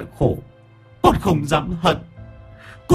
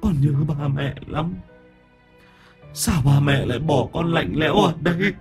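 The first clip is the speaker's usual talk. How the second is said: ghost weeping; 10 semitones higher than usual. A narrator's reading. Drowned boy's ghost crying to his aunt and uncle. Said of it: "Minh nức nở".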